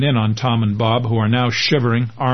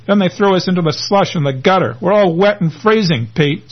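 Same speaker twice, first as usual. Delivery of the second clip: higher, strained, close to tears; almost in tears; 7 semitones higher than usual. Acted. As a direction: plead it, nearly crying. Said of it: "almost in tears".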